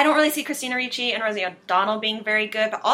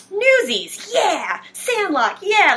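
excited; 11 semitones higher, much slower than usual. Retold or imitated. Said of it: "I was like".

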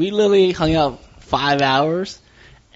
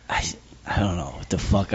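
whispering; 7 semitones lower, 6 dB quieter than usual. Real, not acted. Trailing off about the girl.

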